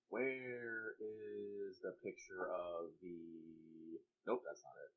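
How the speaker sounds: very quiet, talking to oneself; absent-minded muttering. Real, not acted. Player to self while hunting for a picture, muttered absently. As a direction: mumble under your breath while searching.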